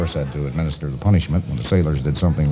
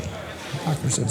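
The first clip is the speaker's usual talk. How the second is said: ordinary talk, nothing marked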